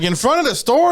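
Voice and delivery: high, amazed disbelief; shocked question. Retold or imitated. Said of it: "I'm like".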